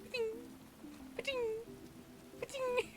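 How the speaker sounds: twang it out like a bow firing; playful ba-ding shots, last one jumping high; mock bow sniping sounds. Gamer retelling archery headshots, mock bow sounds. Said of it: "I was just there, there like".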